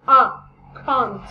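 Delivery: A and can't drawn out long and open, like ah, cont; the long open vowel of car; British pronunciation. Shown as a demonstration. Teacher stressing the British vowel for students. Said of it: "esse A ... som de O".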